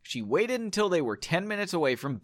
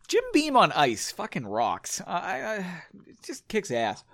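ordinary delivery, nothing marked

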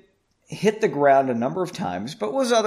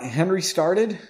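ordinary delivery

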